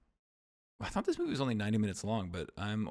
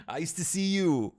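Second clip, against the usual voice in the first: put-on Conan accent; 9 semitones higher, 6 dB louder than usual. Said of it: "He's like".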